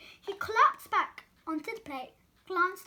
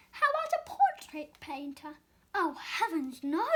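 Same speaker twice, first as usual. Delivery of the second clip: ordinary delivery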